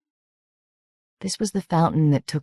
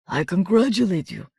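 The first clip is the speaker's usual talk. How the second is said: hoarse soldier's voice; 5 semitones higher than usual. Acted. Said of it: "she said in her hoarse soldier's voice".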